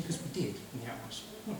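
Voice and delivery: ordinary talk, nothing marked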